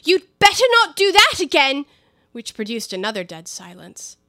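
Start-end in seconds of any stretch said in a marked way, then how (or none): 0.0-1.8 high, loud angry shout; shouted warning. Acted. Alice shouting at the animals throwing pebbles, loud and high. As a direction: shout them down. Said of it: "and shouted out".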